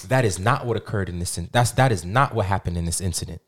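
formal, low statement reading; official statement read. Acted. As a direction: read it like a press release.

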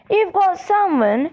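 disbelieving shout. A narrator's reading. Official shouting toward the trees, mildly disbelieving. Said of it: "shouted Mister Crouch".

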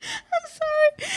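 high, crying-laughing sorry; laughing apology. Real, not acted. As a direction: apologise through helpless laughter.

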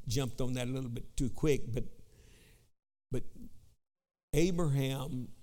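ordinary delivery

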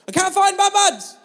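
put-on high, loud wail from a little girl; a kid losing it over her toy; panicked child shout. Daughter shouting to Dad in the car, high panicked wail.

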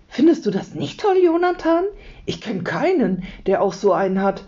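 put-on excited child, starting high; high, eager burst of enthusiasm; enthusiastic. A narrator's reading. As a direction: gush with delight, name pitched right up. Leo enthuses about the Nix.

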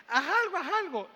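put-on a frantic kid begging; desperate child. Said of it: "el nene desesperado le dice". (niño desesperado suplicando, agudo y fuerte)

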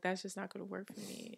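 ordinary talk, nothing marked

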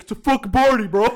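high, loud rowdy quote; mock party yell. Host imitating prankster answering the old people, shouting. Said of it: "And he's like".

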